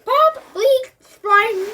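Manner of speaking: high loud silly sound effects; noise imitation. A narrator's reading. Terry's stupid hat going off, loud silly noises.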